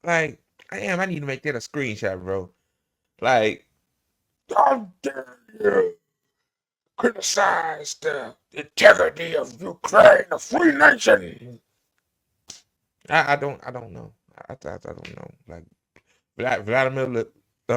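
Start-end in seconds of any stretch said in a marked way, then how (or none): 4.5-11.6 high, loud hammed-up outrage; mocking senator. Streamer parodying the senator's scolding, high mock outrage.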